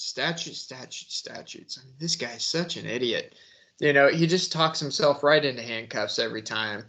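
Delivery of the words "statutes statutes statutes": fading mock echo. Mocking his habit, echo fading out.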